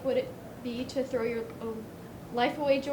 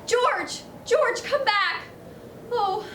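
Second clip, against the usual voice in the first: calling out; 8 semitones higher, 5 dB louder, much slower than usual. Acted. Clarence calls after fleeing George.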